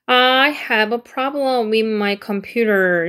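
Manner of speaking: teacher reading each word slowly and clearly; slow model reading. Shown as a demonstration. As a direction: model the sentence slowly for the class. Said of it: "이렇게 되는 거죠".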